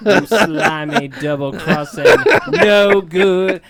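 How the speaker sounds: laughing mock Southern insult; Southern insult demo. Shown as a demonstration. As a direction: lay the Southern on thick, cracking up. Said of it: "sound very Southern".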